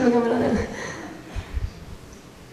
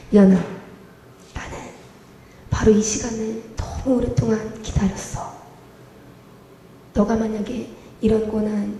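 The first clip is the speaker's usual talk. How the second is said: God's tender voice; 4 semitones lower, 8 dB quieter than usual. Retold or imitated. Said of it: "하나님의 음성이 들려오는 거예요".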